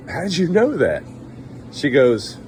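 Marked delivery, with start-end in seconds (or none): 0.1-1.0 high-pitched, curious question; pleased surprise. Acted. Dentist asking the woman after her compliment, pleased surprise. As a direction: ask in pitched-up mock amazement. Flattered dentist asks how she knew.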